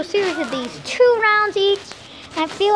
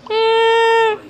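mock whiny baby; 6 semitones higher than usual. Scared by bear, mock whine.

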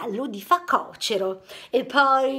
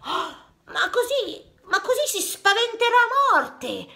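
shocked; 8 semitones higher than usual. Acted. Shocked objection.

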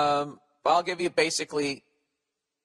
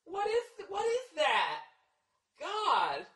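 very high, soft mock girlfriend whine; hammed-up high girlish gossip; mocking gossiping woman; 5 dB quieter, much slower than usual. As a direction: squeak it like gossiping friends at a wine bar. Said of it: "with your female friends just going".

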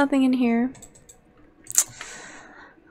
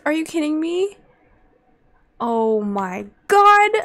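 ordinary talk, nothing marked

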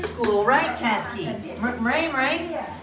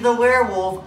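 ordinary talk, nothing marked

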